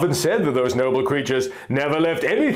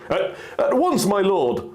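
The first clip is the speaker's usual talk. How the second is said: high, flustered stammer; 6 semitones higher than usual. Acted. Guard Palin flustered before the hero, stammering. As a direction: trip over the first word nervously. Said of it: "Palin stammered".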